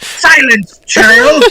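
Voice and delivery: high, sharp order to be silent; commanding shout. Acted. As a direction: cut him off with a loud rebuke. Character silencing NPC.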